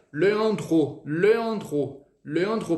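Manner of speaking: "Leandro" slow, nasal French vowels; teacher saying his name in French. Shown as a demonstration. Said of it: "Meu nome em francês".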